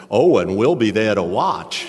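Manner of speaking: loud, drawn-out singsong teasing; gleeful taunt. Retold or imitated. Friends promising to watch Tommy squirm, gleeful taunt. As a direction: stretch it out loud, swooping up and down.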